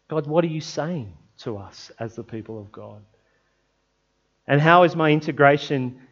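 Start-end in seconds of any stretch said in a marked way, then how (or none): 0.1-3.0 softer, lower hushed prayer. Acted. Preacher asking God for the church, hushed. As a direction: ask God quietly. Prays for the community.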